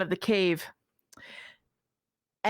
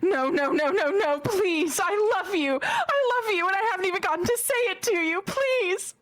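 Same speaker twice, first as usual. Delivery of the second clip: high, rising, frantic begging; Sona's desperate plea; 12 semitones higher than usual. Acted. Sona begs her dying love to stay, high and desperate. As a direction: beg her not to die, voice climbing. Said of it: "Sona goes".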